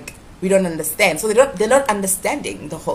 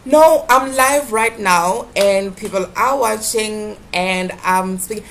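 to someone hard of hearing; 2 semitones higher, 7 dB louder than usual. Real, not acted. Explaining to caller.

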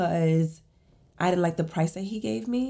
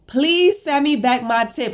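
louder, higher mock demand; 6 semitones higher, 6 dB louder than usual. Asking the cleaner for the tip, mock demand. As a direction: demand the tip back with a laugh. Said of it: "I was like".

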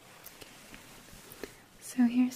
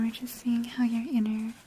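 normal talking voice

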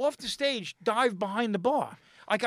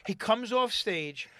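ordinary talk, nothing marked

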